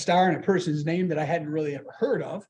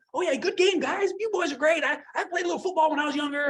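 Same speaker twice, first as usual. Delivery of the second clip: higher-pitched imitated Southern accent; Jeff Foxworthy; 7 semitones higher than usual. Comedian praising the football players, Southern accent. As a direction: put on a chummy Southern twang, pitched up. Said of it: "it was a Southern accent".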